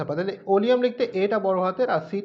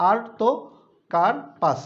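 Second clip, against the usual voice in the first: আর্তোকার্পাস stretched out very slowly, syllable by syllable; 3 semitones higher, 4 dB louder, much slower than usual. Shown as a demonstration. Teacher breaking the jackfruit name into syllables, very slow. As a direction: draw each syllable out clearly. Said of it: "syllable আকারে ভাঙালে সুবিধা হয়".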